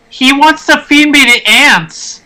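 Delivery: lightly voiced put-upon kobold, slow; alarmed kobold. Kobold tattling on the party, mildly alarmed. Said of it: "he says".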